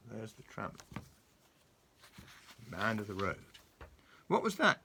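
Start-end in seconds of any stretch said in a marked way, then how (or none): 4.3-4.8 high, loud frightened cry; mock shriek. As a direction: shriek at the noise in the grass. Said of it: "shrieked Mister Jelly".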